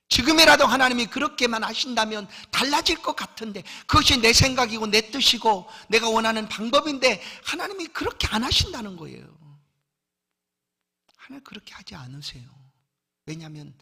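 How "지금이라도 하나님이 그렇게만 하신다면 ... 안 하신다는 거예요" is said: loud, high impassioned preaching; raising the voice to press the point home; full-force preaching. Acted. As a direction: preach it out with fire.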